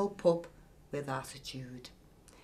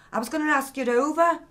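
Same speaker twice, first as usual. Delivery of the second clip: quick, louder and higher in self-defence; defensive; 9 semitones higher, 8 dB louder, faster than usual. A narrator's reading. Ollie defending himself to Nicky, quick and loud. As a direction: rush to justify yourself. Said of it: "Ollie said defensively".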